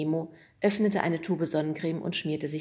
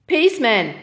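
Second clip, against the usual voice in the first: calling out; 7 semitones higher, 12 dB louder than usual. Acted. Postman calls out leaving.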